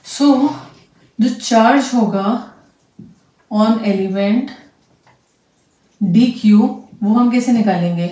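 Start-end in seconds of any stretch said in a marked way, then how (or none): none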